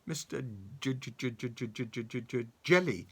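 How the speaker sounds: stuck on the J, repeating it in fright; stammering. Acted. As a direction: stammer out the name. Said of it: "stammered Mister Jelly".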